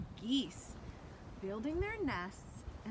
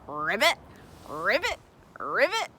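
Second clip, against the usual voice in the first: frog croak; 9 dB louder than usual. Pretend. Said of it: "What does the frog say?".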